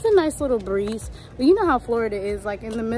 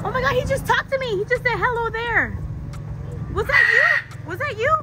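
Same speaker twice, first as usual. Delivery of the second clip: amazed disbelief; 11 semitones higher than usual. Real, not acted. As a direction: blurt it out, amazed the bird spoke. Thinks the parrot talked to him.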